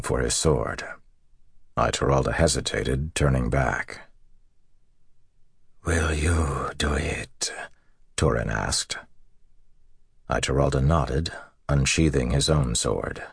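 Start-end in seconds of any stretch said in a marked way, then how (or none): 5.9-7.5 slow, whispered plea; wounded general, all breath and no voice; dying whisper. A narrator's reading. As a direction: whisper it as a last request. Dying general asks for death.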